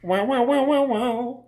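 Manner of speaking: high wah-wah voice like a talk box; talk box guitar imitation. Friend imitating [name]'s talk box guitar, high and wailing. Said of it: "with the voice thing".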